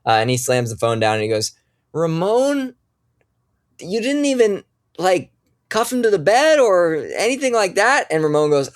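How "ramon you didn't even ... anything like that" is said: high, incredulous scolding; exasperated sheriff. Acted. Sheriff scolding deputy Ramon for not cuffing, high and exasperated. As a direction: scold Ramon in a much higher voice.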